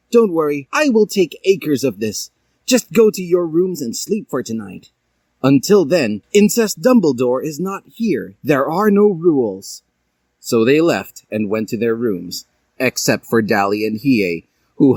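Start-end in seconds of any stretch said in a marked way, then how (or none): 0.1-9.8 higher, reassuring teacher; calm the students; Jack Skeleton. A narrator's reading. Jack Skeleton reassuring students, higher voice. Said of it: "and he said".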